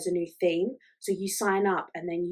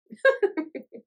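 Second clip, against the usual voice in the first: high laugh; 13 semitones higher, 5 dB louder than usual. Real, not acted. Laughing at own joke.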